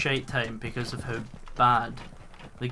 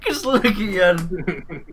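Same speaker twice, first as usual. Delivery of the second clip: mocking echo through laughter; laughing mimicry; 5 semitones higher than usual. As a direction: repeat it while cracking up.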